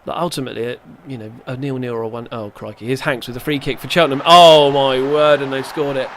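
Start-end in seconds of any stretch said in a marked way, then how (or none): 4.2-5.4 much louder, slow drawn-out dismay; stunned exclamation. Real, not acted. Manager reacting as the opponent scores. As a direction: stretch it out as the goal goes in. Free kick goes in.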